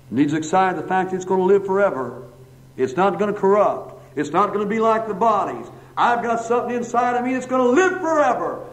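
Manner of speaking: rising pulpit crescendo; fervent preaching. Real, not acted. Preacher builds to a peak.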